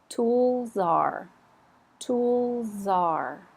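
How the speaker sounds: Tool czar, stress on Tool, soft z joined to czar; linked soft z. Shown as a demonstration. Teacher linking tool czar for students, stress on tool. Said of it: "very soft, and it's definitely not the stress syllable".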